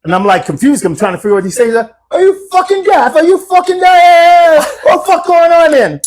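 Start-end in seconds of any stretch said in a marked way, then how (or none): 2.1-6.0 very high, drawn-out English mock outrage; imitated English accent. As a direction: screech it in a hammed-up English accent. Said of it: "I love your accent".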